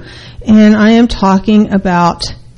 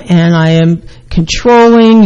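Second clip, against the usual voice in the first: ordinary talk, nothing marked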